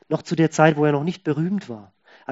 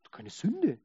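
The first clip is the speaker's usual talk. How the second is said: clueless incredulous people; 4 semitones higher, 5 dB louder, slower than usual. Retold or imitated. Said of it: "dann sagen alle".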